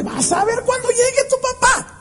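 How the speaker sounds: high-pitched mock maternal threat; scolding mother. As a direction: imitate a mother warning her child, pitched high. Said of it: "Y se la pasa diciéndole".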